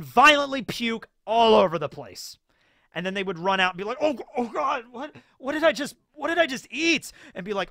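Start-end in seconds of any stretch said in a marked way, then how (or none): 4.0-7.1 high, panicked gasp with a laugh; panicked customer. Retold or imitated. Customer who just ate venom waffle, high panic. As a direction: squeal it in disgusted horror.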